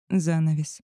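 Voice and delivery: plain reading voice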